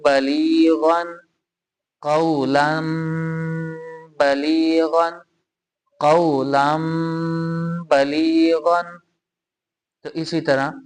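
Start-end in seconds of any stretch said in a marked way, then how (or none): 0.0-9.0 बलीगन very slowly drawn out with a nasal hum; slow recitation. Shown as a demonstration. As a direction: recite कौलम बलीगन slowly, as in Quran reading. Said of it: "मीम को पढ़ा जाएगा".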